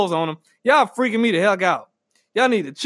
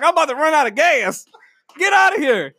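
higher-pitched, exasperated complaint; mock impatience; 6 semitones higher than usual. Driver honking at the gas pump, mock-impatient. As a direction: hurry them along, comically fed up. Acted yelling at slow pump user.

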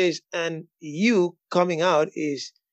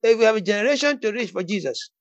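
forceful, raised declaration of faith; fervent preaching; 4 semitones higher, 3 dB louder than usual. Real, not acted. Preacher telling listeners they will live, fervent preaching. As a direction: preach it boldly for the amens.

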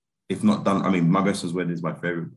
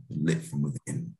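hushed repeat, then a heightened plea; Jimmy Stewart; 4 dB quieter than usual. Retold or imitated. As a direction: act out a dramatic movie line. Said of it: "He's very dramatic".

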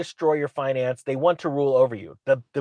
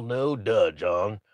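usual speaking voice

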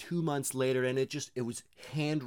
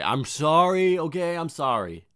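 loud apology; 5 semitones higher, 9 dB louder, slower than usual. Real, not acted. Apologising after outburst.

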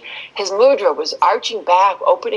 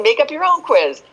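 insistent inner voice; 8 semitones higher than usual. Retold or imitated. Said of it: "this voice said to me again".

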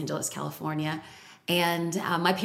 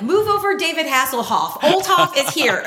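much higher, louder, grand hero intro; heroic announcement; 7 semitones higher, 6 dB louder than usual. Retold or imitated. Ex-lifeguard rushing to help, mock heroic fanfare. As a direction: announce yourself like a TV rescuer.

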